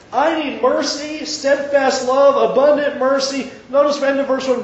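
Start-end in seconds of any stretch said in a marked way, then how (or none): 0.1-3.5 high, earnest plea for mercy; pleading preaching. Acted. David begging God for mercy, high and fervent. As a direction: cry out for mercy.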